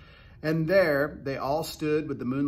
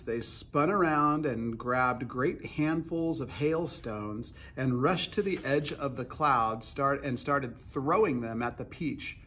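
plain reading voice

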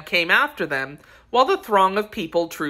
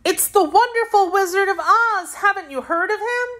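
put-on high, bright-eyed child voice; a young girl informing her friend, high and eager; little girl Dorothy; 3 dB louder than usual. A narrator's reading. Child Dorothy explains the Wizard.

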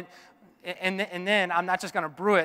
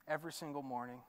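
softly, almost to himself; quiet start of a refrain; 4 semitones lower, 12 dB quieter than usual. Acted. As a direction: begin the refrain hushed and gentle.

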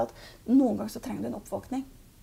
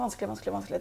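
echo; 2 semitones lower, 4 dB quieter, much faster than usual. Acted. Medium imitating the word returning from the hills, fading echo. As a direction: let it bounce back, fading in quick repeats. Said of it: "så kommer ... tilbake".

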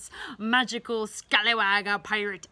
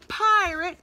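dramatic reveal; 8 semitones higher, 7 dB louder than usual. Acted. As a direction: announce the dinosaurs with awe.

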